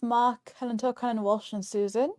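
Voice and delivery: ordinary talk, nothing marked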